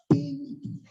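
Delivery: high imitated alert chime; little vocal ding; phone notification bing.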